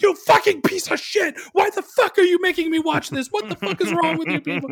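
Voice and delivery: high, loud imitated rage at the screen; furious screaming. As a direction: scream at the movie like an outraged viewer. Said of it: "getting fucking furious at the people who made her watch this".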